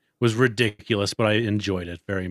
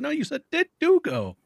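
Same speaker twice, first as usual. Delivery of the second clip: high mock correction. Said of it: "She said".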